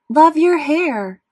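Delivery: high, loud and slow gushing; swooning girl voice. Acted. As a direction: drag it out like a lovesick fan. Fake love note from Beulah.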